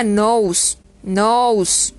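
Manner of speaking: NOSE como "nous", con diptongo "ou"; pronunciación modelo. Shown as a demonstration. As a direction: di NOSE alargando "ou". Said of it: "y se pronuncia". (NOSE pronunciado "nous", con e muda)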